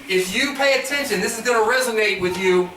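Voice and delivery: usual speaking voice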